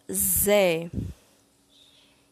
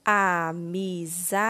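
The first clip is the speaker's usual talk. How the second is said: AMIZADE drawn out slowly as a, mi, za, de; syllable by syllable; much slower than usual. Shown as a demonstration. Teacher completing the word with syllable dé, slowly syllable by syllable. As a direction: sound out AMIZADE one syllable at a time. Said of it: "Iremos utilizar a sílaba dé".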